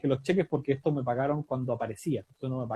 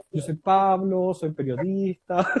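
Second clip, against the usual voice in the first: slow, deliberate introduction; 3 semitones higher, 5 dB louder, slower than usual. Retold or imitated. New student introducing himself to classmates, slow and deliberate. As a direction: spell out the name slowly, almost ceremonial.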